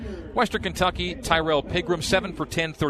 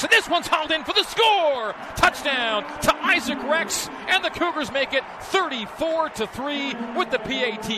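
touchdown call; 10 semitones higher than usual. Real, not acted. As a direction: call the catch with a lift. Replayed radio touchdown call.

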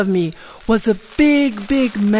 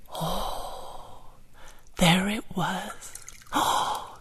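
breathless awe; 10 dB quieter, much slower than usual. Acted. Looking into the magic hole.

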